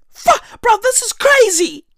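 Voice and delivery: high, disbelieving exclamation; incredulous outburst. Real, not acted. Vlogger reacting to the goat story, high incredulous outburst. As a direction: voice climbing in disbelief.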